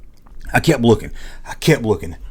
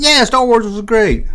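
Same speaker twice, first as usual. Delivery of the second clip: friend's voice; 11 semitones higher, 3 dB louder than usual. Retold or imitated.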